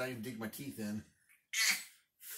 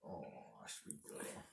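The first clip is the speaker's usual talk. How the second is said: tender whisper; 12 semitones lower, 14 dB quieter, much slower than usual. Real, not acted. Soothing baby.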